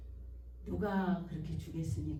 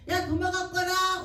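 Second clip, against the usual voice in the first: mother calling; 10 semitones higher, 7 dB louder than usual. Retold or imitated. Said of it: "엄마가 그래".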